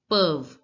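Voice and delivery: peuvent as one syllable, "puhv"; short, firm, falling peuvent; silent ent. Shown as a demonstration. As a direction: stop peuvent on the v. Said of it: "E N T is not pronounced in French".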